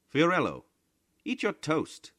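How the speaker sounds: high, loud motherly call; wife's call. A narrator's reading. Maria urging her husband to eat his toast, high and loud. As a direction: call him to breakfast, high. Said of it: "said his wife, Maria".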